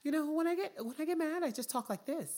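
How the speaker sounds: very high-pitched, hammed-up impression of a defensive woman; mock Becky voice. Woman denying she switched accents when mad, high mock voice. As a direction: imitate her squeaky-high protesting voice. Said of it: "Where'd your accent go".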